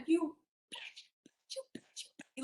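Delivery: high hissed whisper, repeating; whispered insult. Retold or imitated. Aunt cursing while smacking the wheel, hissed whisper. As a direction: whisper it in venom. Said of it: "But she went".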